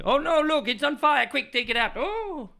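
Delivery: high, loud hammed-up panic; mock panic. Chef panics when his pie catches fire, high and loud. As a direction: flap about like the kitchen is burning.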